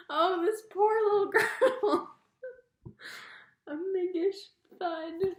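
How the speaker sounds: teary laughing pity; slow, laughing through tears. Real, not acted. Reader pitying the girl, laughing. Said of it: "laughing and crying".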